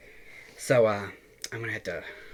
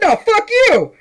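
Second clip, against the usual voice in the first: much higher and louder yell of defiance; mock yell; 9 dB louder, slower than usual. Retelling how he yelled back at the scammer, mock shouting.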